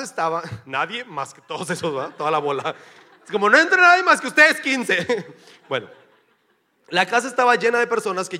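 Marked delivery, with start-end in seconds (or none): 3.5-5.1 loud, laughing mock order to keep everyone out; mock bouncer shout. Jesus turning the crowd away at the door, loud laughing shout. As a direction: bark it like a doorman, laughing.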